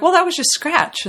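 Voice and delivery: high. Retold or imitated. Quoting executives.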